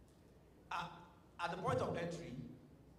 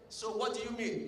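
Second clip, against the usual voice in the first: puzzled retort; 7 semitones higher, 5 dB louder than usual. Retold or imitated. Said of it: "And I was like".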